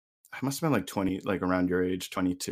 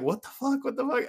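shocked friends; 14 semitones higher than usual. Retold or imitated.

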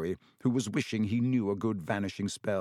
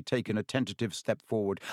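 ordinary delivery, nothing marked

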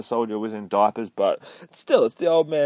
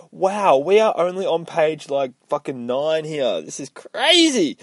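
higher, louder, real astonishment; amazed excitement; 6 semitones higher, 3 dB louder than usual. Real, not acted. Host marvels to listeners at page nine, thrilled amazement.

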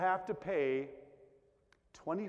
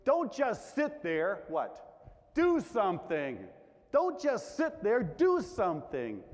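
pushy advice; 3 semitones higher, 5 dB louder than usual. Retold or imitated. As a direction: push someone to get moving. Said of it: "somebody saying to you".

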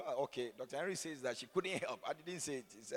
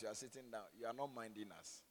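ordinary talk, nothing marked

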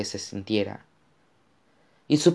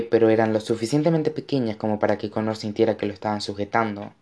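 plain reading voice

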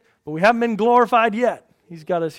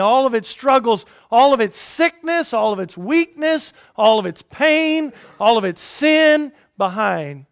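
louder, building preacher's list, each all hit; rising preaching; 4 semitones higher, 7 dB louder than usual. Acted. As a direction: preach it, swelling toward the end.

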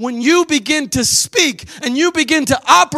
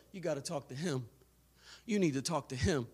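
hushed inner voice; 10 semitones lower, 18 dB quieter than usual. Acted. Inner voice of God.

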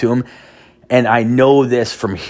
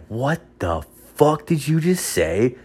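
put-on higher, softer, slow stunned kid; kid's shocked retort; 6 semitones higher, 4 dB quieter than usual. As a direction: play the shocked nine-year-old. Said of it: "looks at me like".

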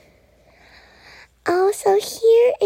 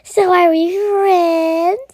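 slow, louder hopeful question; eager unicorn; 3 semitones higher, 5 dB louder, slower than usual. Acted. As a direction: stretch it out hopefully and loudly. Unicorn eagerly asks to be friends.

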